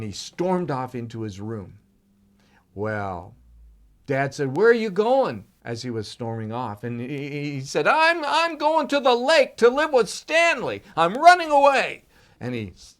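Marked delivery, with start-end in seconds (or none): 4.6-5.5 calling after. Acted. Dad calls after storming son. 7.9-12.0 put-on high, loud child's defiant yell; defiant shout. Boy storms off in tantrum.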